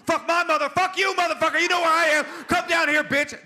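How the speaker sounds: very high, screamed rage; cop losing composure. Retold or imitated. Cop exploding at caller after insult, furious scream. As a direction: explode into full-force screaming. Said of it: "He went from this".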